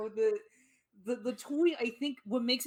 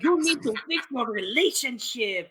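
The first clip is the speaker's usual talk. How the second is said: loud, hammed-up Mexican-accented book character; over-the-top Mexican accent; 6 dB louder than usual. Mexican self-help book lecturing the parents, hammed-up Mexican accent. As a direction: put on a broad Mexican accent and belt it out. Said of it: "the over-the-top Mexican self-help book".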